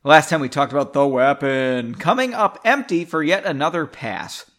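plain reading voice

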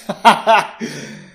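loud mocking laugh; mage's laugh. Lead mage laughs at the prince, loud and mocking. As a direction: laugh him off. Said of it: "The lead mage laughed".

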